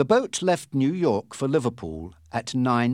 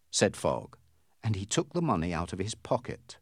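ordinary delivery, nothing marked

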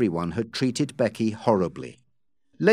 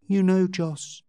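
higher, lightly put-on shy voice; intentionally shy; 5 semitones higher than usual. A narrator's reading. Becky to Joss, deliberately shy. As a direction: make it a touch coy and bashful. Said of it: "in an intentionally shy voice".

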